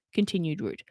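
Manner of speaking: plain reading voice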